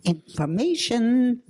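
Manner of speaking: high, loud chirpy operator. Acted. Telephone operator answers the redial, high and chirpy. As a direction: sing out like a bored switchboard operator.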